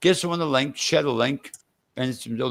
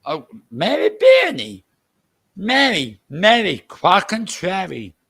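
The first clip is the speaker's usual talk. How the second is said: drawn-out, higher and louder hail; excited greeting; 4 semitones higher, 4 dB louder, slower than usual. Real, not acted. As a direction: call out to a friend who just arrived. Hailing a newcomer to the stream.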